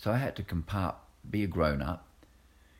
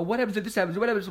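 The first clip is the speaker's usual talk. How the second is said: high, louder fretting questions; mock worried people; 5 dB louder, much faster than usual. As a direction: imitate anxious worriers. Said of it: "People go".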